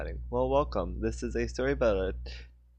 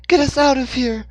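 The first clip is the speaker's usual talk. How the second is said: much higher, loud shriek; 10 dB louder than usual. Acted. Hannah shrieks at Dixon amid explosions. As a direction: scream it in panic. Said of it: "she was shrieking".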